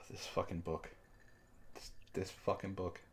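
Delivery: ordinary talk, nothing marked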